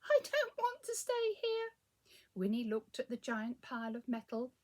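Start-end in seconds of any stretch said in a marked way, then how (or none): 0.1-1.8 high, loud whining little cat; upset cat. A narrator's reading. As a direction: whine like a frightened pet. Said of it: "said Wilbur".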